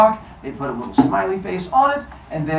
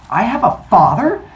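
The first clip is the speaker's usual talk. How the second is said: higher, louder incredulous question; stunned young Zeus, hammed up; acted astonishment; 6 semitones higher, 6 dB louder than usual. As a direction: rise sharply in mock disbelief. Zeus learns he has a father.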